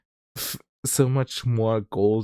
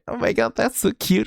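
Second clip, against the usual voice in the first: gushing viewer; 12 semitones higher than usual. Retold or imitated. Voicing gushing viewers' reactions.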